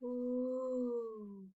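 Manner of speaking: soft, eerie ghostly call; icy moan. Acted. Tentacle monster calling from under the bed, icy. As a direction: breathe it out cold and spooky. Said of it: "an icy voice called".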